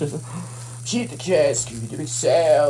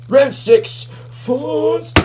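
booming fighting game announcer; 8 semitones higher, 3 dB louder, much slower than usual. Pretend. Fighting game round call.

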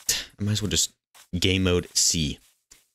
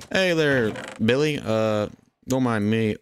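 high singsong hello; fake-innocent greeting; 4 semitones higher, slower than usual. Thief greets villager while taking the bed, fake-innocent. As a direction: sweet-talk them in a raised pitch.

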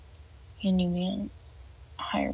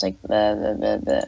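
croaky breaking voice, much lower; cracking voice; 11 semitones lower than usual. Real, not acted. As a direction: voice cracking and dropping low. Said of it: "like, cracking".

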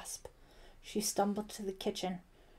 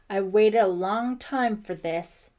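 loud, slow, with a touch of trembling; 11 dB louder, slower than usual. A narrator's reading. Wilkins finally facing Brett with pistol, trembling voice. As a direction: draw it out with strong feeling. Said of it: "he said in a trembling voice".

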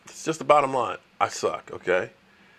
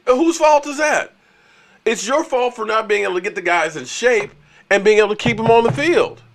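fired-up rant; 8 semitones higher, 4 dB louder than usual. Real, not acted. Ranting at rival Eagles fans.

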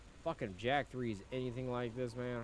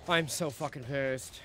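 angry; 3 semitones higher, 7 dB louder, slower than usual. Real, not acted. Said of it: "I'm fucking livid".

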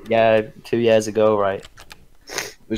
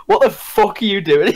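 raised, higher mock-indignant demand; retold telling-off with laughter creeping in; mock confrontation; 7 semitones higher, 3 dB louder than usual. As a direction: imitate an outraged older brother, half laughing. Acted confrontation by older brother.

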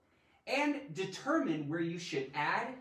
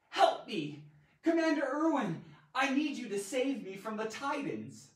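mock plea; 6 semitones higher, 3 dB louder than usual. Acted plea for rescue, then slower reread.

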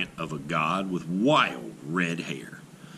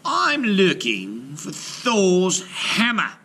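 much higher, slow questioning voice; a sly trickster asking, pitched up; Loki's voice; 9 semitones higher, slower than usual. A narrator's reading. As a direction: Loki asking the frost giant, high and slow. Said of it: "asked Loki".

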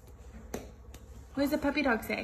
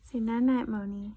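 ordinary talk, nothing marked

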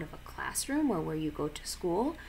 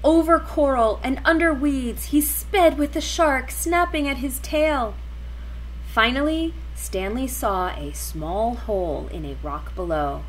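excited narration; 5 semitones higher, 7 dB louder than usual. A narrator's reading.

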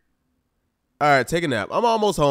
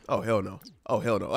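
muttered disbelief; 9 semitones lower, 8 dB quieter than usual. Real, not acted. Sees buddy flirting with wife.

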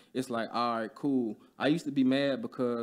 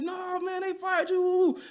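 voicing coworkers; slower than usual. Coworkers' reply, voiced high.

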